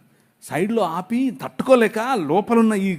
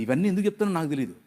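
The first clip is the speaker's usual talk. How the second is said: ordinary talk, nothing marked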